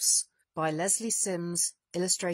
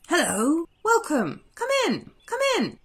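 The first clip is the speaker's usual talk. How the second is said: much higher, eager dwarf voices; squeaky little dwarfs welcoming a guest; bouncy, high dwarf greeting; cheerful dwarfs; 9 semitones higher, 4 dB louder than usual. Acted.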